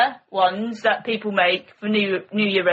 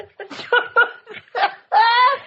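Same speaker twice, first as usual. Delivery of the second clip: high, breathy squeal of laughter; real helpless giggle; 13 semitones higher than usual. Real, not acted. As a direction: let the laugh burst out high. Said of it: "we'll get back there in a minute".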